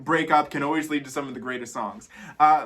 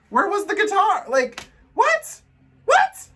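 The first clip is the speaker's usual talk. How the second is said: disbelief; 5 dB louder, slower than usual. Real, not acted. Outraged reaction to missing guitar.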